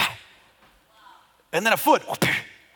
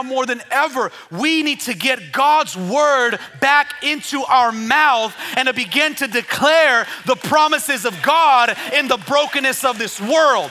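raised, driving declaration building in intensity; full-force preaching; 8 semitones higher, 6 dB louder than usual. Real, not acted. Preacher declaring God's word to the church, full-force preaching. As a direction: preach it with forceful punched phrases.

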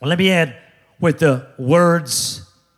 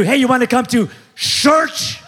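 raised, slow, called-out offer; mock eager invite; 5 semitones higher, slower than usual. Pastor mocking eager invitations to Chick-fil-A, called out slowly. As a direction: shout the invite like an overeager friend.

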